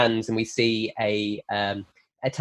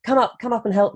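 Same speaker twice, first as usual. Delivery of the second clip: higher, light, sweet invitation; a charming young woman luring a guest upstairs, sweet and higher; sweet charming girl; 8 semitones higher than usual. Acted. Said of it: "And she says".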